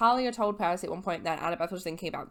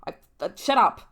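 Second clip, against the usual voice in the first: sharp shut up; 3 semitones higher, 9 dB louder than usual. Retold or imitated. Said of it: "she's like".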